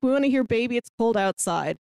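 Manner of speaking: usual speaking voice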